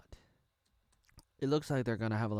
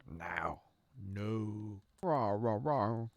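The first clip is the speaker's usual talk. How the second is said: door guard; 2 semitones lower, much slower than usual. Acted. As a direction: play a doorkeeper growling a password. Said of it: "the guy at the door inside is like".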